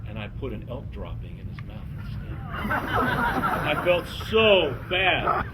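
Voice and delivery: plain reading voice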